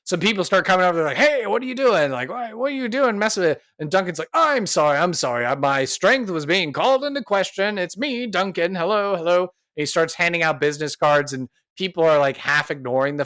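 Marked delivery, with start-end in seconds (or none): 1.2-3.6 high-pitched challenge from onlookers; alarmed townspeople. Acted. Townspeople confront the pair, acted. 4.3-9.4 high-pitched, showy damage control; smooth it over like a local celebrity; placating apology. Acted. Duncan placates gathering townspeople, acted.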